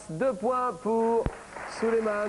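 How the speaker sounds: high, slow drawn-out points call; game show announcement. Real, not acted. Quiz host to contestants, slow high announcer call. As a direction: stretch the words like a quiz host crowning a winner.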